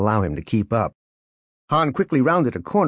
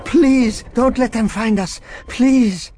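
loud, high, desperate plea; fearful pleading; 14 semitones higher, 5 dB louder, slower than usual. A narrator's reading. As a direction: beg for protection in terror. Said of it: "stricken with fear".

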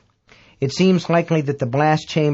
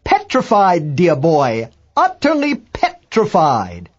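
high, drawn-out mock-hearty exclamation; Dagbolt exclaiming; 4 semitones higher, 4 dB louder, slower than usual. A narrator's reading. Amateur astronomer answers a reporter before liftoff, high theatrical exclaiming. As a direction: exclaim it grandly, stretching the last words. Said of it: "and exclaimed".

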